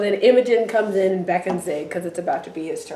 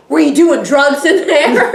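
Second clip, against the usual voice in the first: laughing accusation; 6 semitones higher, 7 dB louder than usual. Retold or imitated. Becky accusing Jonah.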